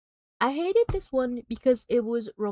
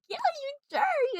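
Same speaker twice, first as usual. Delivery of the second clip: very high, drawn-out mock yell; hammed-up, high-pitched indignation; retold angry jeer; much slower than usual. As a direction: squeal the insult at the screen, slowly.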